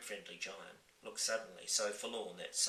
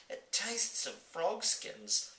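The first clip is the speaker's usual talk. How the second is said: high, slow gasped disgust; gasping girl; 9 semitones higher, 5 dB louder, much slower than usual. Acted. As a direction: gasp it out in disgust. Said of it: "she gasped".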